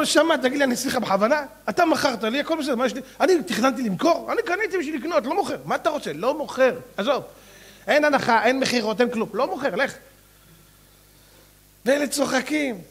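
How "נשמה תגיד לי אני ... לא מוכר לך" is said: higher, insistent refusing; stubborn refusal. Acted. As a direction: dig in, won't sell. Seller refusing in story.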